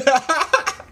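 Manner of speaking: high-pitched burst of laughter; real laughter. Real, not acted. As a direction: crack up helplessly. Speaker reacts to ugly monster.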